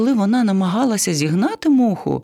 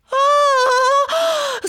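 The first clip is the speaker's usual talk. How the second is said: long shrieking parrot wail; high drawn-out cry of panic; high panicked scream; 3 dB louder than usual. Acted. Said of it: "зарепетував папуга". (пронизливий вереск папуги)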